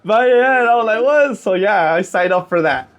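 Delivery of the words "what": high, sharp disbelief; shocked what; surprised what. Retold or imitated. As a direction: react like you can't believe it. Shocked reaction retold.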